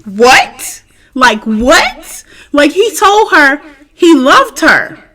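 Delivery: can't believe what he just heard; shocked disbelief. Real, not acted. Reacting in disbelief to the confession.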